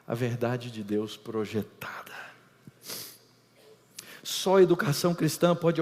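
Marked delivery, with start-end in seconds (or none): 0.1-2.1 soft, lower whisper. Real, not acted. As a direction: breathe the conclusion. Conclusão sussurrada. (sussurro baixo)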